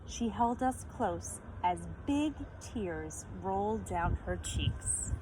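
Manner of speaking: usual speaking voice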